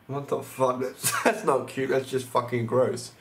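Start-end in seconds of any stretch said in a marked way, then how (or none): none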